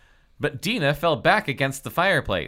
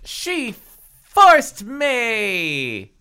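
very high, drawn-out cry; 12 semitones higher, 9 dB louder, much slower than usual. Acted. Bewildered Halle defends herself, crying out. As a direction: wail the excuse melodramatically. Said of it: "Halle cried".

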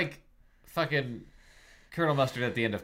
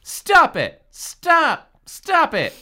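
very high, loud repeated shrieks; shrieking; 10 dB louder, much slower than usual. Acted. Halle tries to stop the fight, shrieking. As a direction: shriek each one as a separate burst. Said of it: "Halle shrieked".

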